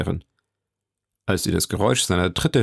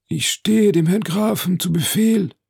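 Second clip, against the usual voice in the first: high, eager-to-serve character voice; obliging Major; 10 semitones higher, 3 dB louder than usual. Acted. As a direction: offer yourself deferentially, pitched up. Major dient sich dem Grafen an. (dienstbeflissener Major, mit hoher Stimme)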